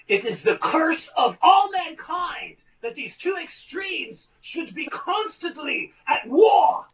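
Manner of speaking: low, grand theatrical recitation that climbs into a shout at the end; Jekyll stage speech. Retold or imitated. Actor reciting Jekyll's speech to the whole cast, grand and theatrical. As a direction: declaim it like a Broadway Jekyll addressing the cast. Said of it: "a whole long speech that Doctor Jekyll makes".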